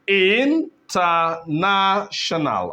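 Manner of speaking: "international" slowly as in, ter, na, tio, nal; measured, drawn-out breakdown of "international"; syllable by syllable. Shown as a demonstration. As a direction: space out each syllable of "international". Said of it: "words that are five syllable here".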